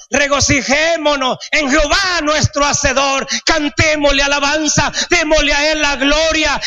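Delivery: rally the church to rejoice, shouting; full-force preaching. Acted.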